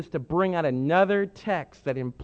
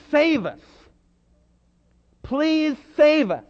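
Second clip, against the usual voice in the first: pleading; 7 semitones higher, 4 dB louder, slower than usual. Acted. Pleading meaning of Hosanna.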